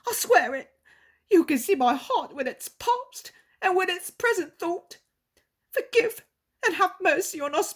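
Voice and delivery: high, loud, desperate begging; pleading innkeeper. Acted. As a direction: beg the Lord on your knees for your child. Innkeeper begs for daughter.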